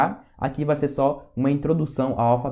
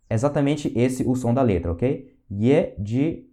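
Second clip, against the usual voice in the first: ordinary delivery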